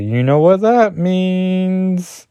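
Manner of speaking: slow, high, teasing put-on voice; a slow high sly hint; sly grinning tease. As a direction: drag it out with a knowing grin. Grinning hero teasing Spider-Man.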